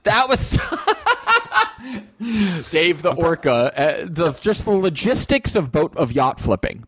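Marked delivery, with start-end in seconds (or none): 0.6-2.6 squealing high laugh. Real, not acted. Laughs at bird idea.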